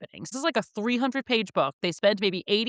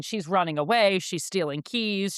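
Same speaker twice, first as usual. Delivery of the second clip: ordinary talk, nothing marked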